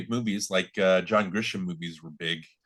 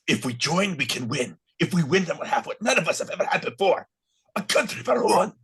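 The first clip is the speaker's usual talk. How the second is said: put-on higher-pitched Scottish accent for a movie speech; 7 semitones higher than usual. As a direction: deliver the rallying line in Scottish. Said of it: "say it in your best Scottish accent".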